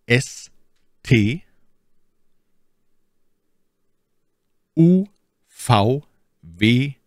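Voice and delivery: reciting the alphabet letter by letter; each letter name said slowly and clearly with pauses; spelling it out. Shown as a demonstration. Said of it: "Wie buchstabiert man das jetzt?".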